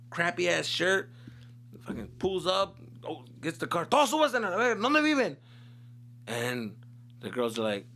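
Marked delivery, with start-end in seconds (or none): 3.9-5.4 high, loud angry command; dad barking orders. Retold or imitated. Angry dad ordering the kids into the car, high and loud. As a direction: order everyone into the car, fuming.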